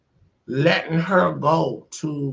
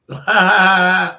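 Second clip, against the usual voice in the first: loud forced fake laugh; 5 semitones higher, 9 dB louder than usual. Husband mocking wife after the fight, loud fake laugh. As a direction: laugh too hard on purpose. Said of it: "Fake ass laugh".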